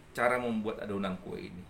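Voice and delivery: ordinary talk, nothing marked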